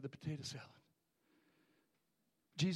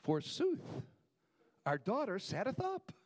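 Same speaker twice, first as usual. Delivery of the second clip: higher, stiff King James voice; mock archaic; 6 semitones higher, 3 dB louder than usual. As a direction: ham up an old-fashioned Bible tone. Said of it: "I don't think Jairus and his wife went".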